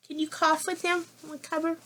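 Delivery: normal talking voice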